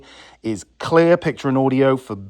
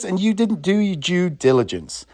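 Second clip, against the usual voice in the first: normal talking voice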